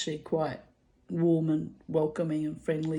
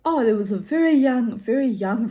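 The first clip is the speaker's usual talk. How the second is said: excited; 6 semitones higher, 8 dB louder than usual. Real, not acted. Excited recollection building up.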